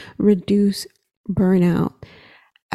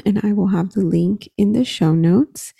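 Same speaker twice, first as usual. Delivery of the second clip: ordinary delivery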